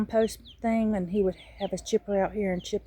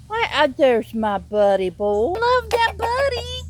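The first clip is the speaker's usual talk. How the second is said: slow, high doting coo to a pet bird; cooing pet talk; 11 semitones higher, 4 dB louder, slower than usual. Real, not acted. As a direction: dote on the bird, drawing it out.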